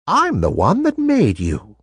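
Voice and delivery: put-on a kind old lady revealing a secret; kindly old toy maker.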